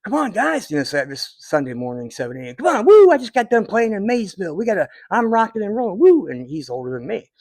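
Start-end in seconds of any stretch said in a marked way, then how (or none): 0.0-0.7 much higher, louder, eager "come on guys"; imitating Eddie. Eddie urging the band early morning, high eager impression. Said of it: "He would be like". 2.5-6.3 hyped-up, much higher and much louder, faster whooping; Eddie all fired up. Retold or imitated. Eddie back from a Maysville gig, high whooping hyped impression. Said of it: "He would be like".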